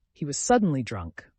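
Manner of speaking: ordinary delivery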